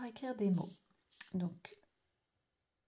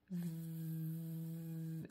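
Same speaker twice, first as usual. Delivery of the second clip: soft, long buzzing v; held sound; 2 semitones lower, 5 dB quieter than usual. Shown as a demonstration. Teacher writing the letter v, held sound. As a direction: hold V as one continuous sound.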